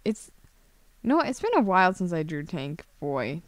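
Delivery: ordinary delivery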